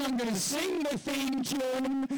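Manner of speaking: high, excited bragging Ben Affleck; hammed-up eager boasting; mock Batfleck. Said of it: "Batfleck was like".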